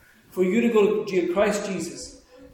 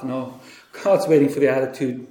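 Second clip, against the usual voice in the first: ordinary talk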